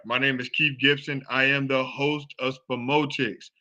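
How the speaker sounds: ordinary talk, nothing marked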